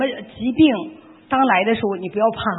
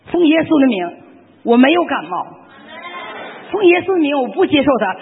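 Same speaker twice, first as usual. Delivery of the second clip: higher and louder, proclaiming 奉耶稣的名; bold declaration; 5 semitones higher, 4 dB louder than usual. Shown as a demonstration. Sister showing the group how to resist illness, loud proclamation. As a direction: rebuke the sickness like a command. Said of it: "第一件抵挡".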